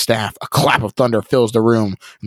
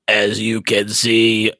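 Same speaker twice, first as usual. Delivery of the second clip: slow, hollow evil voice; slower than usual. Acted. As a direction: speak slowly like an evil spirit. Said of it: "the evil one's hollow voice".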